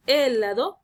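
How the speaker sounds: "helado" stressed on the first syllable, É-lado; wrong first stress. Shown as a demonstration. Teacher showing another wrong version, first syllable stressed. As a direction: punch the opening e of "helado". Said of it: "no es helado".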